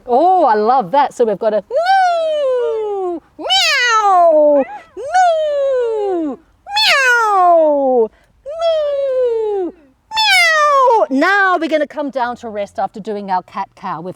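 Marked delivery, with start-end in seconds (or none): 1.7-11.1 long high swooping moos and meows; zooming animal cries, falling away; cat and cow fireworks. Shown as a demonstration. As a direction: alternate stretched high moo and meow. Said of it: "what would the cow fireworks sound like".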